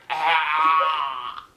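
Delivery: loud, drawn-out "ah" yell; exaggerated horrified cry; retold scream. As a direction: let out a long, loud mock scream. Retold horrified reaction to film.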